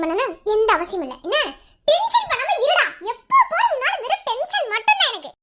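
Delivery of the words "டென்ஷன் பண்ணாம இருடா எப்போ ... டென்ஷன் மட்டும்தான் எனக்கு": exasperated higher-pitched complaint; irritated. Acted. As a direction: snap at him, pitch lifted, fed up. Couple quarrel over her outing. (எரிச்சலாக)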